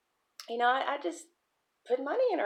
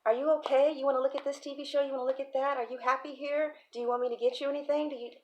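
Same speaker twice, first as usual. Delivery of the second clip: quick, higher stream of caring questions; fussing nurturer; 5 semitones higher, much faster than usual. Acted. Aunt doting on visiting relative, rapid higher-pitched fussing. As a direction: pester with offers, barely pausing. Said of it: "she's constantly nurturing".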